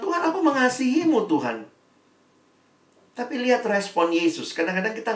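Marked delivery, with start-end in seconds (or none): 0.0-1.6 high, earnest voice of Peter swearing his love; Peter pleading. Retold or imitated. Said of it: "Petrus tuh sampai bilang". (Petrus memohon dengan suara tinggi)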